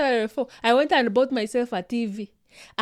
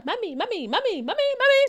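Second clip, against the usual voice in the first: put-on insistent sing-song child calling, climbing higher; pestering child; 7 semitones higher, 4 dB quieter than usual. Kids calling a tired parent just home from work, pestering. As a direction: nag like a kid who won't stop. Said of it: "kids here".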